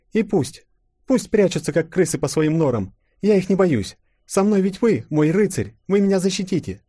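high, lightly voiced girl; young princess. A narrator's reading. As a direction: greet him with relief. Tsarina to her knight. (Анастасия радостно отвечает Колчаку)

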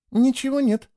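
high-pitched, let-down remark; disappointed. A narrator's reading. Girl finding nothing on the hand, disappointed. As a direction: report the letdown. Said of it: "разочарованно произнесла она".

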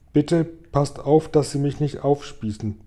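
ordinary delivery

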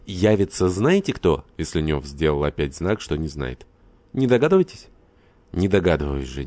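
ordinary delivery

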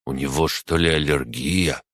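plain reading voice

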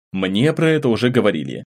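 plain reading voice

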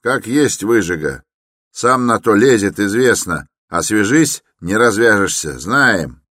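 dismissive porter. A narrator's reading. Big porter decides against getting involved, loudly dismissive. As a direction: dismiss the troublemaker firmly and loudly. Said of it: "решил большой дворник".

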